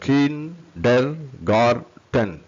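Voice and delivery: very slow, stretched KIN - DER - GAR - TEN; four long separate beats on kindergarten; syllable by syllable. Shown as a demonstration. Teacher counts four sounds for learners, syllable by syllable.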